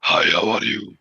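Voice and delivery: possessed other voice. Retold or imitated. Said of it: "when she was the other, other person".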